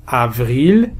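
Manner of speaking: ordinary talk, nothing marked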